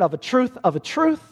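mock-solemn of a truth; rising emphasis. Shown as a demonstration. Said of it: "you could translate it".

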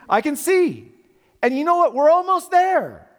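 high, excited cartoon voice; character's line. Preacher quoting a cartoon character, high and thrilled. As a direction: squeal it out like a kid's show character.

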